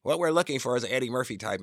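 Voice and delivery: a hint of a quick casting call; casting caller. Voices film line at the screening.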